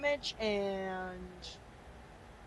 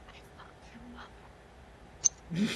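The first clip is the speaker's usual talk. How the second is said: barely audible, voiceless taunt; acted secretive whisper; 2 semitones higher, 6 dB quieter, faster than usual. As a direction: whisper an insult under your breath. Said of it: "Like a ... whisper".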